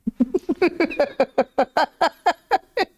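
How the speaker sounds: a burst of genuine amusement; real laugh. Real, not acted. Reader laughs at the passage.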